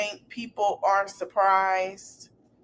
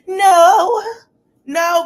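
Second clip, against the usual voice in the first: emphatic no; 9 semitones higher, 10 dB louder than usual. Acted. Rejecting the pacifying idea.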